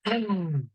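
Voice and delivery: falling sigh. Real, not acted. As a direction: let out a sigh after the affirmations. Pause after closing affirmations.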